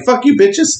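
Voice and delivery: high, laughing taunt; mock triumphant farewell; gleeful taunt. Retold shout driving off.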